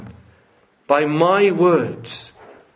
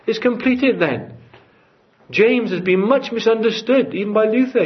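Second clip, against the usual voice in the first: higher, louder, climbing pulpit fervour; rising preaching; 8 semitones higher, 3 dB louder than usual. Acted. Preacher to congregation, building fervent and high. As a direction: build the sermon to a peak.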